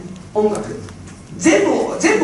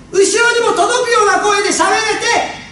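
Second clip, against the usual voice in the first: 後ろにも届く carried much higher to the back; projecting voice; 10 semitones higher than usual. Shown as a demonstration. Speaker showing the audience a voice reaching the back, projected. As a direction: throw the voice to the back row.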